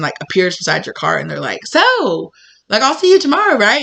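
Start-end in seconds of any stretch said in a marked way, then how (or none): 1.7-3.8 much higher, louder sing-song menace; syrupy and unsettling; creepy sweet. Acted. Suspect's creepy sweet question.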